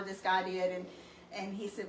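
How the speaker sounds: usual speaking voice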